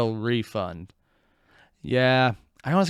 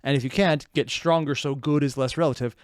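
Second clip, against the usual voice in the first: normal talking voice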